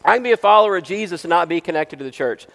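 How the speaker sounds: loud, high, rapid mock quote; mock quoting. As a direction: rattle off the objection loudly and dismissively. Mockingly repeats the objection he rejects.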